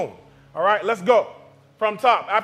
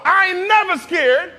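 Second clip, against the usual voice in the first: loud and high, slow, stress on never with a rising end; bold declaration; 10 semitones higher, 4 dB louder than usual. Shown as a demonstration. Preacher urges the congregation to say the title, loud and high. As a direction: shout it out with full confidence. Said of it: "Come on".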